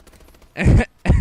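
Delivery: loud, helpless burst of laughter; real laugh. Real, not acted. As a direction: break up laughing at the memory. Cracking up at his own story.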